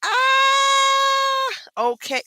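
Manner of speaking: high shouted ah then a resigned okay; mock surprise. To her uncle, a high startled shout then okay. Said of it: "And I was like".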